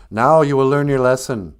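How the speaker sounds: scolding spirit, declaiming; stern pronouncement. Acted. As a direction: pronounce the lesson forcefully. Spirit pronouncing the hunter's lesson.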